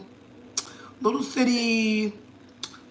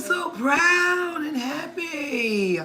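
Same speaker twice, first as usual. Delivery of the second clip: happy tears; 9 semitones higher, much slower than usual. Real, not acted. Hears of son's marriage, grandkids.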